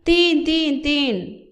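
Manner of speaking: put-on boy bent under a rider shouting his guess; shouted guess. A narrator's reading. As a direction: call the number out desperately. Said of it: "साधू ओरडला".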